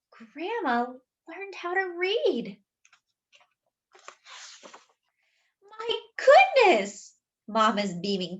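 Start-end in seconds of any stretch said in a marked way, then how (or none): 0.0-7.1 astonished. A narrator's reading. Family amazed that Grandma has learned to read, astonished cry. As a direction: gasp as if you can hardly believe it.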